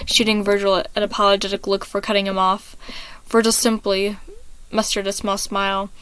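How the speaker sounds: ordinary delivery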